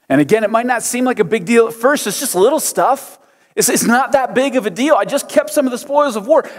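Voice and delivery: high whining Saul pleading with God; whiny excuses. Acted. Voicing Saul's excuses to God.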